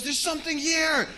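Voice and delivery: very high, loud warning yell; full-volume alarmed cry; panicked scream. Retold or imitated. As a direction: scream it out in terror. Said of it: "when you hear someone screaming".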